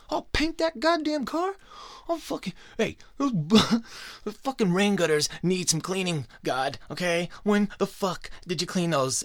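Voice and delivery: hammed-up servant begging to be useful; eager servant guy. Eager human servant pitches himself.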